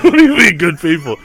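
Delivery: high, loud, laughing incredulity; squawked mock outrage through laughter; mock disbelief. Repeats the line, mock disbelief.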